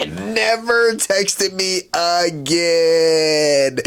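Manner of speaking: very slow, stretched out, Never texted me again; drawn out. Real, not acted. Lamenting to viewers she ghosted him, slow and stretched. Dramatic repeat of the ending.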